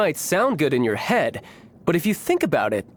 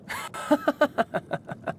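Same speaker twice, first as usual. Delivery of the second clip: a short, soft, breathy chuckle; breathy laugh; 10 semitones higher, 5 dB quieter than usual. Acted. Warrior laughs at being called no match, soft chuckle. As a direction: laugh it off under your breath.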